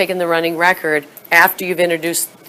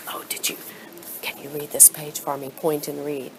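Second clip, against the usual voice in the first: soft, hushed prompt to a reader; quiet teacher voice; 13 dB quieter than usual. Shown as a demonstration. Teacher quietly asks a child to read, softly. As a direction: lean in and ask gently. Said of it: "she's gonna whisper".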